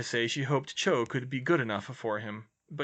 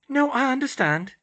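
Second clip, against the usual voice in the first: high, loud, flustered assurance; flustered high-pitched babble; babbling; 14 semitones higher, 8 dB louder than usual. A narrator's reading. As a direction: rush to reassure, embarrassed. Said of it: "she babbled".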